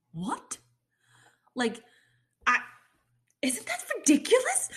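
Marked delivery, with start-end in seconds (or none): 3.4-4.7 high, laughing question; laughing disbelief. Real, not acted. Mocking angry fans, laughing in high disbelief.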